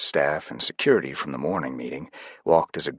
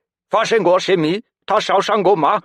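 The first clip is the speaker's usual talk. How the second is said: higher, louder sharp Chinese; higher, louder crisp Chinese call; 9 dB louder than usual. A narrator's reading. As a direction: bark it crisply. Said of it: "sharp, crisp Chinese".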